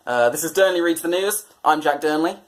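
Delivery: ordinary talk, nothing marked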